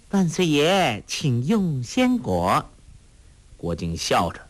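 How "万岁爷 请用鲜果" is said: drawn-out, higher servant's address; mock-ceremonious offering to the emperor, stretched out; kneeling servant. A narrator's reading. Girl playfully serving her friend as emperor, ceremonious. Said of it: "屈膝说道".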